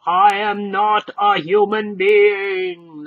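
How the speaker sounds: slow, loud harsh golem declaration; slow, loud monotonous golem insistence; loudly. Acted. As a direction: boom it out slowly. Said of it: "the stranger said loudly".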